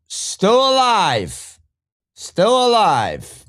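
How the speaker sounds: high, slow stretched still alive; drawn-out exclamation. Real, not acted. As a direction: draw it out, high and gleeful. Gleeful drawn-out taunt.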